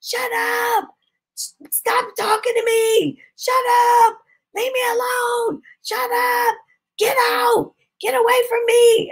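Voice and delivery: imitating high-pitched frantic screams; mock scream, pitched far above your own voice; retold screaming. Possessed boy screaming at the preacher to stop, imitated high scream. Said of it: "he started screaming at me".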